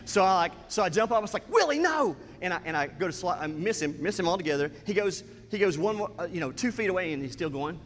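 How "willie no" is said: high, sharp retold scolding; scolding the dog. Owner jumps up at dog about to go indoors, scolding. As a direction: yell at the dog, high-pitched.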